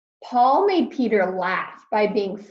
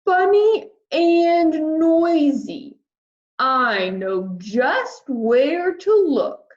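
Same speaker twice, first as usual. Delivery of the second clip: big, louder whale greeting; friendly whale voice; 6 semitones higher, 4 dB louder, much slower than usual. Acted. Whale greeting lost Peter, big and friendly. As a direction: boom out a kindly hello.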